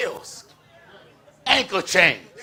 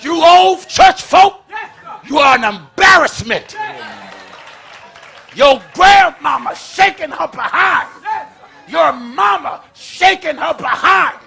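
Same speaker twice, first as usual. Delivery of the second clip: fiery shouted preaching; 8 semitones higher, 7 dB louder than usual. Acted. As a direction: preach it hard to the pews. Preaching to the congregation.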